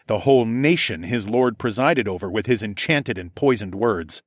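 ordinary delivery

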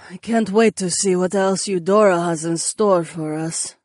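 dry sarcastic remark. A narrator's reading. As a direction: say it as a dry joke. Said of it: "you're being sarcastic".

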